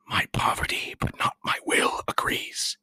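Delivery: put-on thin whispered voice of a scared old man; frail apothecary whispering; fearful apothecary. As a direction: whisper like a wretched recluse. Apothecary reluctantly agrees.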